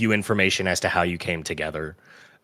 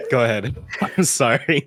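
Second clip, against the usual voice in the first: laughing; 6 semitones higher than usual. Real, not acted. Laughing through an apology.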